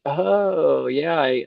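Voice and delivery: drawn-out, high "oh" of dawning understanding; sudden realization. Acted. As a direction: act out finally getting the joke. Voices someone suddenly getting it.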